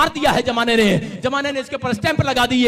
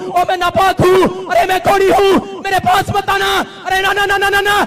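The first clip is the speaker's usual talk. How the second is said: frightened shouted warning, higher and louder; frightened leper; 8 semitones higher, 3 dB louder than usual. Acted. Leper crying out to Jesus to stay back, frightened shout. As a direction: cry out in fear to keep him away. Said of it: "डरते हुए बोल रहा है".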